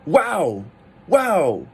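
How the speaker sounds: high "Wow" swooping sharply down; surprised fourth tone. Acted. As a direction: exclaim "Wow" in acted amazement.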